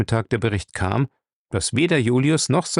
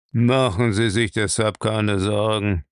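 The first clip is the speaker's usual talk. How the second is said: put-on slow old boss's voice; unmoved old master. Bellamy reassures his angry servant, slow and unmoved. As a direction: take your time, unperturbed.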